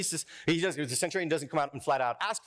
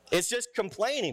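ordinary talk, nothing marked

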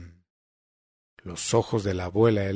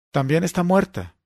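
Agatha exclaims it, pitch jumping higher in alarm; a startled, raised cry; exclaiming; 7 semitones higher, 4 dB louder than usual. A narrator's reading. Agatha discovers the bandaged woman, alarmed exclamation. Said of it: "exclamó Agatha".

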